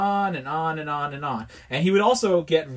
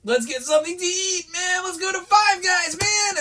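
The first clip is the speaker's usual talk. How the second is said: very high, whiny-eager dude voice; hammed-up impression of a pestering coworker; imitated coworker; 13 semitones higher than usual. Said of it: "he was like".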